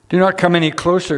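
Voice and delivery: God calls out a quick warning; urgent warning. Acted. God warns Moses off the holy ground, urgent and fast.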